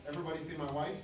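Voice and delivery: normal talking voice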